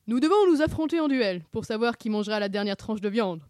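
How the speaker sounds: loud, declamatory duel announcement; mock-solemn knight. Sammy announces a duel over meat, mock-solemn. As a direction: proclaim the terms like a pompous knight.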